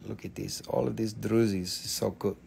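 low, very soft murmur of appreciation; hushed admiration. Real, not acted. Seller admires all of these drusies, hushed admiration. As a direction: marvel quietly, almost to yourself.

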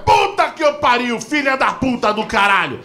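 high, drawn-out mock shouting; enraged father. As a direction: explode like a father at breaking point. Enraged father curses daughter.

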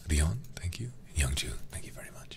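soft whispered thanks, barely voiced. Real, not acted. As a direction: breathe the words out to chat. Quiet thanks to chat.

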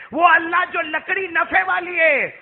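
slow, high, loud proclamation; impassioned pulpit cry; full-force preaching. Acted. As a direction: ring out each phrase to the congregation.

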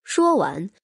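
usual speaking voice

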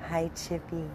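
ordinary talk

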